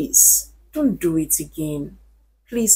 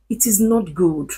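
usual speaking voice

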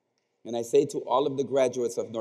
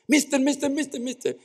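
put-on high, urgent calling of a little girl; frantic girl; 13 semitones higher, faster than usual. Schoolgirl frantically calling the teacher, high-pitched. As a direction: wave for the teacher's attention, frantic. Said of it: "she waved her hand frantically".

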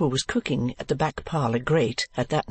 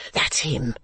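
plain reading voice

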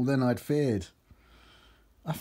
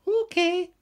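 very high falsetto reply; woman character; 3 dB louder than usual. A narrator's reading. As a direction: pipe up in a put-on woman's voice.

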